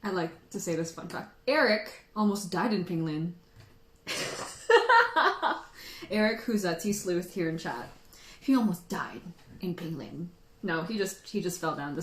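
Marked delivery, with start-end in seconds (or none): 4.0-6.0 high, loud burst of laughter; cackling laugh. Real, not acted. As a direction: laugh hard at a friend's mishap. Laughs about Eric's near death.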